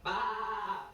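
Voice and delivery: a loud, high bleat in a put-on voice; sheep bleat.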